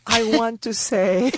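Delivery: a playful hint of a Venezuelan boxer dedicating a win. Guest on radio saying goodnight, mock boxer's shout-out. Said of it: "now I sound like the Venezuelan boxers".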